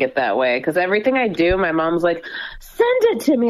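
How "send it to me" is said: high, eager mock mom voice; imitating her mom. Co-host quotes her mom asking for everything, in a high mock voice. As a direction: squeak it like a pushy mother.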